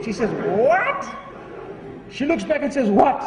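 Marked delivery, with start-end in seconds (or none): 0.3-1.1 high, rising challenge; indignant. Acted. Arrogant woman snaps at parrot. 2.9-3.2 louder rising challenge; indignant. Acted. Woman turns back to challenge parrot.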